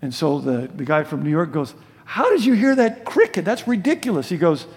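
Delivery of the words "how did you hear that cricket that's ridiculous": higher-pitched, disbelieving retort; incredulous New Yorker. Acted. New York friend challenging the man who heard a cricket, incredulous. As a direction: act out a city man's disbelief.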